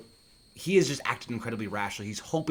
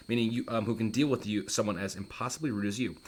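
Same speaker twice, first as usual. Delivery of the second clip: usual speaking voice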